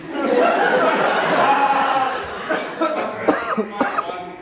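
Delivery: loud burst of laughter. Real, not acted. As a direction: crack up at his own joke. Laughs at ball sack beast.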